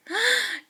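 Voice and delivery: high sharp gasp; shocked gasp. Acted. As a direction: gasp in delight at the news.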